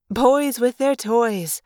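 slow, drawn-out fond teasing; gentle tease. Acted. Cassie teasing the boys about their toys, slow and gentle. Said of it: "Cassie teased gently".

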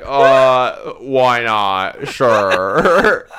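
loud, strained yes; mock reluctant agreement. Driver letting the stranger light up, exaggerated reluctance. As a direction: agree against your better judgment, hammed-up.

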